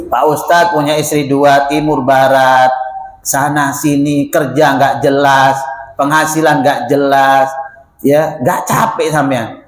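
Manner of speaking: low, slow, needling voice of a doubter; lightly voiced sceptic teasing the preacher; mocking questioner. Preacher imitates people asking if two wives tire him, low and teasing.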